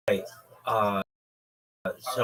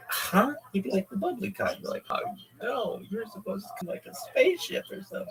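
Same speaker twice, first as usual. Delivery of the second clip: confused high voice; 12 semitones higher than usual. Retold or imitated. Confused friends, Ford Taurus.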